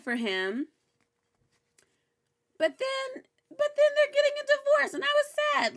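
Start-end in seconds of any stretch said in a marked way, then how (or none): 2.6-5.8 high, whiny mock wail; mock sad. Vlogger reacting to the episode, mock sad wail. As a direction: wail it like a sulking child.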